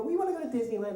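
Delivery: high, childlike imitation; mock children's voice; kid making a request. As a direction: pitch it up like an eager child. Well-behaved kids at family meeting.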